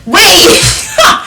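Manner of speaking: high laughing cry of wait; laughing protest. Real, not acted. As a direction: squeal it through a laugh.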